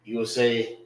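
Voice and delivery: ordinary delivery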